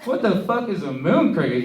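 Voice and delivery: high, loud incredulous question; baffled question. Acted. New coworker puzzled by an outburst, loud bafflement. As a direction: demand to know what that was.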